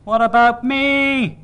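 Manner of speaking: put-on high, loud, drawn-out baby's howl; screaming infant demanding attention; full-blast wail. A narrator's reading. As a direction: wail it out slowly at full volume. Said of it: "I was making noise to drown anything else".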